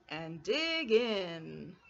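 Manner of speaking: low, slow cozy badger; lightly voiced grinning badger. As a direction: settle in with a grin. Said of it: "Badger says with a grin".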